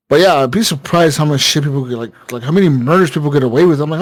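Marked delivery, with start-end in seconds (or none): none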